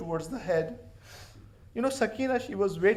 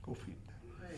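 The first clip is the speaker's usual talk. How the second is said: breathy whispered repeat, much softer; whispered echo; 3 semitones higher, 20 dB quieter than usual. Acted. The Prophet's request echoed back as a whisper. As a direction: let the words fade to a whisper.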